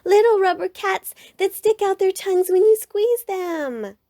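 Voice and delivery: put-on high, loud eager child voice; excited cub shout. A narrator's reading. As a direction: rattle off the toy thrilled. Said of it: "shouted the cubs".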